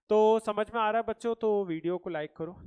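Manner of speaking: loud call to viewers. Real, not acted. Teacher's call to like video.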